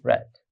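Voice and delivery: রেড with a short e; past-tense pronunciation. Shown as a demonstration. Teacher confirms the pronunciation, রেড said "red". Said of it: "উচ্চারণ রেড".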